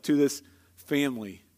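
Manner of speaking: usual speaking voice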